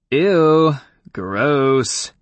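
put-on loud, disgusted valley girl. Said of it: "First impression of a Valley Girl ever".

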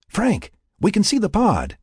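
high-pitched call over a radio. A narrator's reading. Teammate tells Frank the pod is visible, calling out.

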